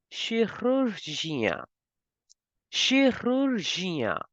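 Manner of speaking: chirurgien slow, with sh and a French r. Shown as a demonstration. Teacher slowly models French chirurgien. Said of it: "we have to make the sound of sh".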